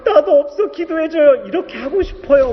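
telling God he has no tuition either, laughing and crying; laughing-crying plea. Retold or imitated. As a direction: plead with God through a laugh and a sob. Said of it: "이런 심정이 드는데".

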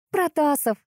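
high-pitched reluctant confession; grudging, voice lifted; forced admission. A narrator's reading. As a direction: give up the name under pressure. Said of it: "выдала вынужденное признание".

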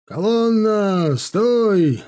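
slow, high, loud command shout; sharp shout. Acted. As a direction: bellow the halt order. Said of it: "Резкий крик Кравцова".

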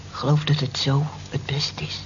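soft whispered secret; confiding whisper. Acted. Jess whispers to Molly in confidence. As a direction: whisper it between friends.

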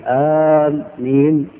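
"آمين" with a long, stretched opening آ; drawn-out alif. Shown as a demonstration. Teacher showing worshippers how the imam begins, drawn-out "آمين". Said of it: "مبتدئا بمد الألف الممدودة".

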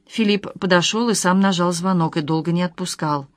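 usual speaking voice

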